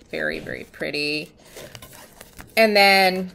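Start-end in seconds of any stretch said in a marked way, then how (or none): 2.6-3.3 talking through hiccups. Real, not acted. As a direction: speak with the hiccups. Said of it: "I have the hiccups again".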